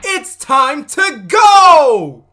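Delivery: very loud, high yell with a long-held last word; comic alarmed shout. Pretend. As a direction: bellow it in hammed-up panic, stretching it out. Comic alarm at scary story.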